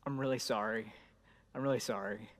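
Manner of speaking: low, hushed, tender apology; soft apology. Retold or imitated. Dad apologising to crying daughter in her room, soft and tender. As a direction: say sorry gently at her bedside. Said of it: "and I'm like".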